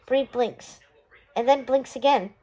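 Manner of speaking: plain reading voice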